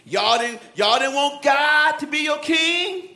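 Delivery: slow, stretched-out reproach; incredulous scolding of the people; indignant Samuel. Acted. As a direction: drag each question out, pitch climbing. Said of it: "He's talking like this".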